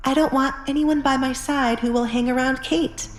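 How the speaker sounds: firm lady setting terms, lightly voiced; a hint of a stern mistress; Alessandra's voice. A narrator's reading.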